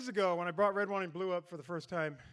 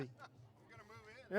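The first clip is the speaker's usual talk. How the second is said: whispered aside; 6 semitones lower, 18 dB quieter than usual. Real, not acted.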